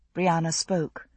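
ordinary delivery

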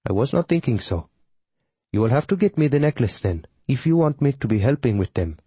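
put-on Tamil accent. A narrator's reading. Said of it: "in her Tamil accent".

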